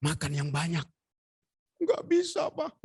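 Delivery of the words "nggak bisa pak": high-pitched refusal; mock high wifely complaint; imitated sick wife. Husband voices sick wife refusing food.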